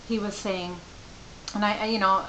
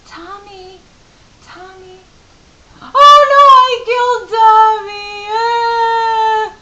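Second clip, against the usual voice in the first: put-on high, then a loud, wailing child's cry; a small boy realizing he broke his favorite toy; panicked child; 17 dB louder, slower than usual. Mother imitates son panicking over the silent toy.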